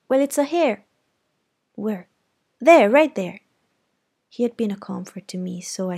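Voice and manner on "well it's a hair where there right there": higher, louder, eager pointing; proud boy. Acted. Jem pointing out his first hair, eager and louder. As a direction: show it off proudly.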